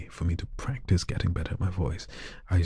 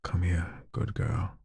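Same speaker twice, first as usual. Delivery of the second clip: flirty request read. Pretend. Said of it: "someone wants me to say".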